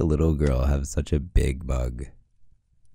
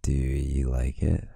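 ordinary talk, nothing marked

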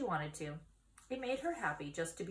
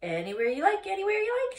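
high, loud goose building up; 13 semitones higher, 9 dB louder, faster than usual. Acted. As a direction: honk it higher on the repeat. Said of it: "she said".

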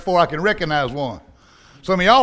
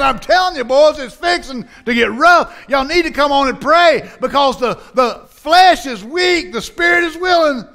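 much higher, shouting, slowing on the flesh is weak; urgent warning Jesus; 6 semitones higher than usual. Retold or imitated. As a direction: warn them it's about to get rough, full voice. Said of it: "but Jesus is saying".

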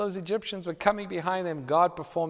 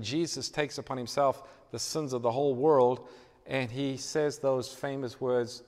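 ordinary delivery

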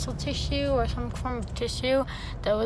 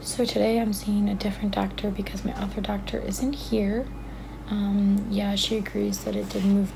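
whispering; 2 semitones lower than usual. Real, not acted. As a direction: whisper so staff won't hear. Filming quietly at dentist office.

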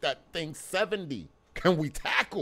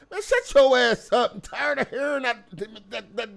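loud, higher exasperated yell; fed-up shout; 6 semitones higher, 7 dB louder than usual. Real, not acted. Fan snaps back at coach.

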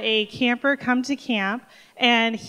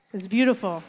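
ordinary talk

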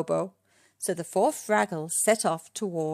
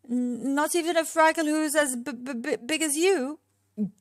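high, stuttering fright; timid, high-pitched stuttered question; nervous stammer; 7 semitones higher than usual. A narrator's reading. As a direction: stumble over the first sounds in fear. Junior stammers, scared of giant Fraggle.

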